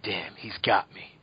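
low, slow whisper; hushed awe. Retold or imitated. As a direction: whisper it, won over by the song. Acted reaction to a song he likes.

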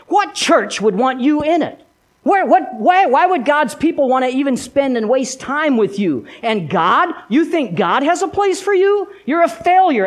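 high, incredulous taunting questions; scoff, then condemn; accusing devil. Acted. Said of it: "he said the same thing he said to Peter".

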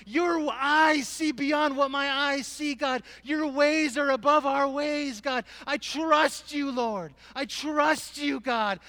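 high, loud, drawn-out declarations to God; heart-out worship. Retold or imitated. Preacher reliving worship in grief, crying out at full force. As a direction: cry it out slowly at full voice. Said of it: "I sang my heart out".

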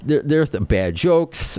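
ordinary talk

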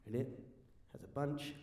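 low, soft suspenseful narration; quiet, careful reveal; hushed storytelling. A narrator's reading. Hushed scene setting in the story.

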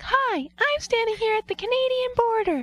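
put-on high-pitched Canadian kid; kid with Canadian accent. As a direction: chirp it like a young Canadian boy. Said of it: "him as a kid".

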